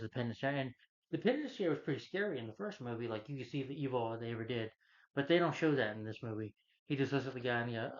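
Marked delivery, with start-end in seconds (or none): none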